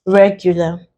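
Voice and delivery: REGULAR with the stress on its first syllable, REG. Shown as a demonstration. Teacher giving an example, REGULAR stressed on the first syllable. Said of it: "we stress the first syllable here".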